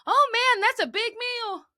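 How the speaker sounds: high-pitched mock amazement; putting on a child's high voice; imitated little girl. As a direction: play a kid making a big deal of it, way up high. Retold child humoring her brother.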